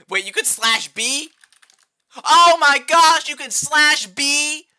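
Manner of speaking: high, loud, drawn-out disbelief; stunned high cry of disbelief; shocked surprise. Real, not acted. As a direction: gasp it out as the shortcut dawns on you. Discovers a shortcut command.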